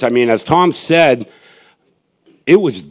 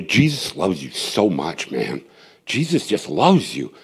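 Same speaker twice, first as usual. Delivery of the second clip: tender reassurance; 3 semitones lower, 6 dB quieter than usual. Retold or imitated. To a frustrated girl on the street, soft tender reassurance. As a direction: lean in close and say it tenderly. Telling the girl Jesus loves her.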